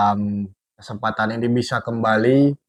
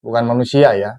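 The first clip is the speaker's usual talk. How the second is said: ordinary talk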